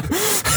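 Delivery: sudden squeaky burst of laughter; high laugh. Real, not acted. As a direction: crack up high and breathy. Laughing at a joke.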